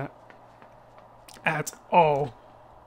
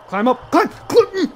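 urgent urging; 11 semitones higher, 8 dB louder, slower than usual. Real, not acted. Urging character to climb.

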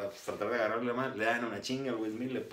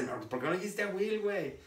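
higher, laughing retort; mock exasperated friend; 9 semitones higher than usual. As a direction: tease him back, half laughing. Retold friend's exasperated reply.

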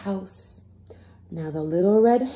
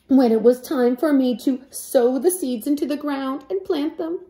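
scolding hen; 6 semitones higher, 4 dB louder than usual. Acted. Hen scolding the lazy animals.

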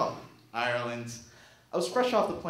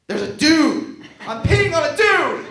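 loud and much higher, alarmed; panicked yell; 9 semitones higher, 11 dB louder, slower than usual. Retold or imitated. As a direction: shout it in sudden shock. Discovering a man in the bush.

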